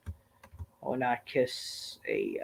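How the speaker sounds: slow whisper of "I would not kiss a"; whispered aside. Real, not acted. Repeats line, then whispers it.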